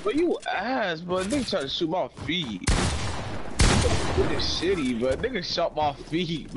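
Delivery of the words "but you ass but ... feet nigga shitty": ordinary delivery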